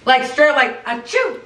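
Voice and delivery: quick high fake sneeze; imitated sneeze. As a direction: squeak out a sneeze. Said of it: "we hear someone sneeze".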